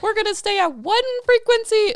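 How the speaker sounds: mock stubborn voice. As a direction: ham up a closed-off person. Mocking closed-off people.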